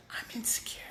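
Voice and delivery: hushed inner voice; whispered confession. Pretend. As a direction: let the secret slip out. Voicing the inner scream.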